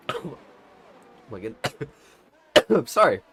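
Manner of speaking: coughing and apologising mid-choke; choking on spit. Real, not acted. Commentator choking mid tyre rundown, coughing apology. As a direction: splutter an apology through a real cough. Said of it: "I just started choking my spit".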